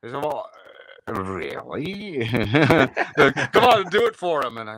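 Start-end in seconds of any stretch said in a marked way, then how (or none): 0.2-2.3 drawn-out, theatrical sceptical reply; Hans Conried. Retold or imitated. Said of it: "I did Hans's voice". 3.5-4.6 high, loud coaxing; urging introducer. Retold or imitated. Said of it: "he does your voice".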